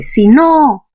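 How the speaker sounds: si NO, with the force on no; stress on NO. Shown as a demonstration. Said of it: "mayor fuerza estaría en el no".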